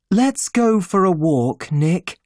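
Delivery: a hint of an eager Daisy; Daisy's voice. A narrator's reading.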